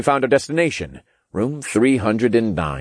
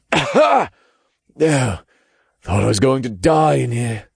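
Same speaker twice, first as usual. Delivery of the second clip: higher, parched raspy voice; raspy, dry voice; 5 semitones higher, 3 dB louder than usual. A narrator's reading. Freed captive to his rescuers, raspy and dry. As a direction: rasp it out, dry-throated. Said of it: "in a raspy, dry voice".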